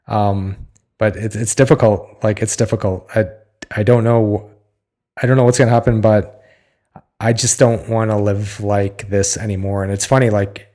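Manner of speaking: ordinary talk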